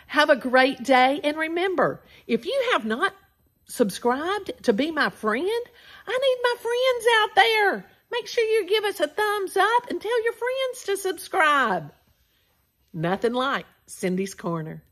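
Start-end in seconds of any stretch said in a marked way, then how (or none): 6.1-11.9 high, pleading playful voice; mock plea. Host pleading with viewers to subscribe, mock desperate. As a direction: beg cutely for subscribers.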